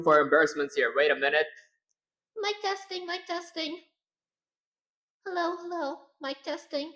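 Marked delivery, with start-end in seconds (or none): 2.4-6.9 very high, soft put-on female voice. Shown as a demonstration. Streamer proving he can talk female, soft high voice. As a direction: lift it high and light, like a woman. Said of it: "I can talk in a female voice".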